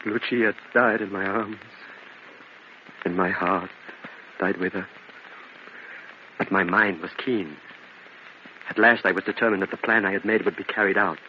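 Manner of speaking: low, quiet storyteller voice; grim story narration. A narrator's reading. Angelo narrates Lucia's death to the listener, low and grave. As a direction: narrate the loss, low and steady.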